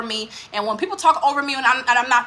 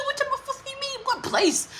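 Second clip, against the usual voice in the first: baffled high squeak; 5 dB quieter than usual. Retold or imitated. To his friend on the phone, a high baffled squeak. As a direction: pitch way up, breathy disbelief. Retold confusion, voice shoots up.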